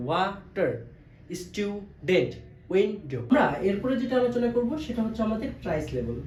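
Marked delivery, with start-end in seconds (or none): 0.1-3.2 ওয়াটার, স্টুডেন্ট, উইন্ডো each split in two; separated two-beat words, ওয়াটার, স্টুডেন্ট, উইন্ডো; syllable by syllable. Shown as a demonstration. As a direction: break each word: ওয়া-টার, স্টু-ডেন্ট, উইন-ডো. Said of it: "আমরা এই ভাগ ভাগ করে উচ্চারণ করি".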